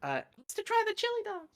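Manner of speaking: high, squeaky tiefling farewell. Acted. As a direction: pitch way up for the tiefling. GM voices tiefling parting line.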